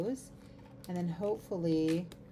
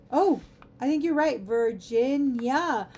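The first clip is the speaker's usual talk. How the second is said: surprised realization; 4 semitones higher, 7 dB louder than usual. Real, not acted. Chat corrected her spelling.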